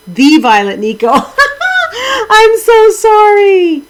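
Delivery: high, slow, laughing through the sorry; laughing apology. Real, not acted. Streamer apologizing to a viewer for the wrong name, laughing. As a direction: giggle out an embarrassed apology.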